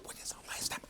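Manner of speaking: very soft, voiceless hiss; yelling without a sound. Retold or imitated. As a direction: scold him in a bare whisper. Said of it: "yell at you without making a sound".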